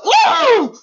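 sudden high loud mock wail. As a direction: imitate the child bursting into a howl. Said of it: "he cried and he was like".

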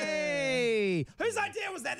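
high accusing mob shout; angry mob. Retold or imitated. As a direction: turn on the dissenter.

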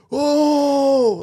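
long, high, loud "oh" scream; high mock shriek; imitated crowd scream. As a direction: imitate passengers screaming in one drawn-out cry. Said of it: "like, scream".

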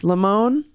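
Limon said lih-MOAN; poll option. Shown as a demonstration. Host listing options, Limon as lih-MOAN. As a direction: stress the end of Limon. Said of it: "Those are the options".

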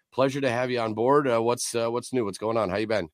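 normal talking voice

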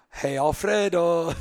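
put-on high, loud, drawn-out call in a Brazilian accent; mother's Brazilian-accented yell. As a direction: holler like a Brazilian mom summoning her husband. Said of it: "yells out in her little Brazilian accent".

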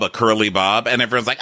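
usual speaking voice

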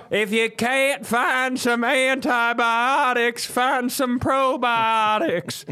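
high, slow put-on wise voice; high, slow pompous advice; mock sage advice. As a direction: dispense nonsense wisdom grandly.

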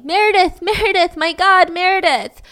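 high-pitched imitated cries; mock hysterical calling, short of a real scream; retold screaming. Roommate crying out her dead friend's name, retold screaming. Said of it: "She just keeps screaming".

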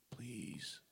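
breathy whispered plea; erotic whisper. Pretend. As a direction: whisper it like begging. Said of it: "pretend I'm getting a hand job".

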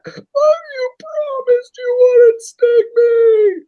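high, slow, dying gasp of a frog. Acted. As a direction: squeak it out with your last breath. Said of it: "Frog's dying with his dying breath".

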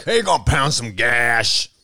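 slow lightly voiced dudebro; a hint of macho band guy; bro voice. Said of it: "I'll say shit like".

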